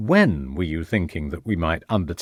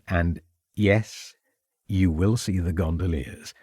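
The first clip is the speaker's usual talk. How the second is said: tender husband. A narrator's reading. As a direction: give in gently, charmed by her. Husband charmed by wife's smile.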